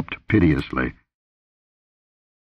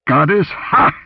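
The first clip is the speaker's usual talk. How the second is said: scornful bark, raised pitch; angry contempt; 9 semitones higher, 4 dB louder, slower than usual. A narrator's reading. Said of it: "His bark was full of angry contempt".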